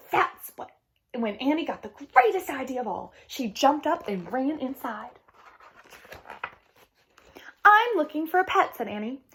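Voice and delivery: lively storybook read-aloud with voiced characters; reading to children. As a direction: read it like story time for kids. Teacher reads picture book aloud.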